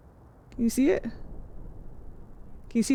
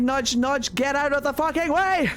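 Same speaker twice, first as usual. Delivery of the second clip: high-pitched, hammed-up yell; mock urgent yell; 5 semitones higher than usual. Streamer acting out shoving through, mock yell. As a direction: play at yelling people aside.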